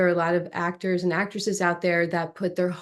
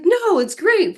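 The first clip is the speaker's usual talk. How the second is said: upbeat; 12 semitones higher, 4 dB louder, slower than usual. Retold or imitated. Agent reassuring.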